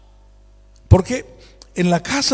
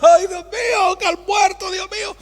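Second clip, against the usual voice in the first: much higher, anguished outcry; crying out to God; 11 semitones higher than usual. Retold or imitated. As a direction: wail it like a desperate leader. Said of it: "Empieza a clamar".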